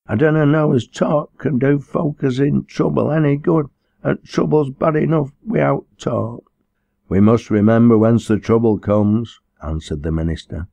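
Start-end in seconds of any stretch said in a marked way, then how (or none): 0.0-6.4 high-pitched plaintive complaint in dialect; whimpering. Acted. Troubled girl protesting to the minister, whimpering. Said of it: "she whimpered". 7.1-9.4 lightly voiced sermonising parson, louder; a hint of the pulpit, firm and loud; preaching minister. Minister admonishing the troubled girl, lightly preachy.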